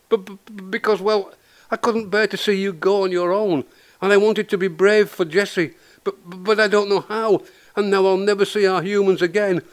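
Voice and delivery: high, plaintive, stuttering cat voice; frightened stammering. A narrator's reading. Frightened Buster confessing to Moggy, frightened stammering. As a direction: stumble into a high-pitched fretful wail.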